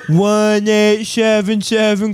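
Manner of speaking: dopey singer voice. Pretend. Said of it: "He's like".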